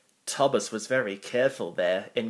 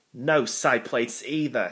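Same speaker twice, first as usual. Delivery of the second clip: ordinary delivery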